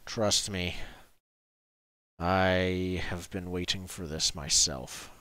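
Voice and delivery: soft and deep, confiding to an ally; grim character reply. Acted. Game master answers as the character.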